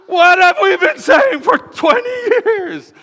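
high, loud, laughing outcry; laughing exasperation. Real, not acted. Pastor to congregation, laughing exasperated outburst. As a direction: laugh it out in disbelief.

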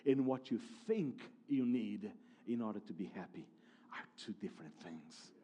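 hushed lesson. Retold or imitated. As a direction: let it sink in, almost under the breath. Holy Spirit's lesson, hushed.

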